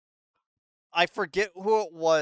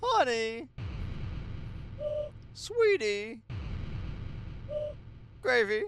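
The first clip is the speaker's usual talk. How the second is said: higher, sugary queen calling her darling; a scheming mother-queen sweet-talking her daughter; coaxing robot queen; 11 semitones higher, 3 dB quieter, much slower than usual. Acted.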